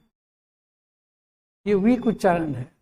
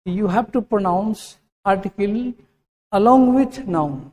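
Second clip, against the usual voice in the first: slow English; much slower than usual. Shown as a demonstration. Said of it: "teacher slows English sentence".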